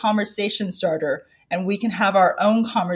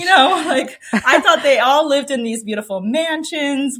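giggling through the words, higher; laughing; 6 semitones higher, 3 dB louder than usual. Real, not acted. Author laughing to the interviewer about childhood envy, giggling. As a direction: laugh it out at the memory.

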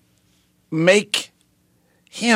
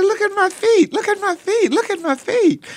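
very high, insistent repeated cry; retold frantic pleading. Newly saved son urging his dad to look, retold frantic cry. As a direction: re-enact an overwhelmed son, voice shot way up. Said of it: "And I'm crying".

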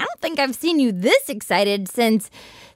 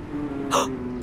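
excited gasp; 7 dB quieter than usual. Acted. Sees a revolving door.

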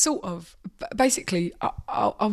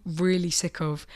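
usual speaking voice

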